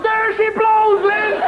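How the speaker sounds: high, drawn-out whaling cry; lookout's call. Acted. Philip alerts the Queen to whales, high hailing cry. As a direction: call out from the crow's nest, very high. Philip calling from crow's nest.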